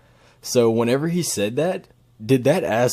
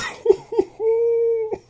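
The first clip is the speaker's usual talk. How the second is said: squealing laugh. Real, not acted. Laughing after flirty line.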